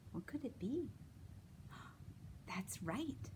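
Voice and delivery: hushed guessing whisper. Acted. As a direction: whisper it like a secret. Whispered guessing game to kids.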